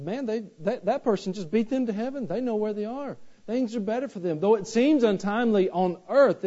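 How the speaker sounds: sermon climax shouted with rising fervor; full-force preaching. Acted. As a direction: lift the congregation with every line.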